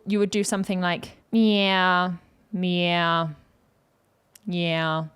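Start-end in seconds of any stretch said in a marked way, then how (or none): 1.3-5.0 narrower, dialled-down twang; lower twang setting. Shown as a demonstration. Coach showing a lower setting on the twang knob, narrow twang. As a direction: twang turned down, narrow and contained. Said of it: "wanna do a lower setting".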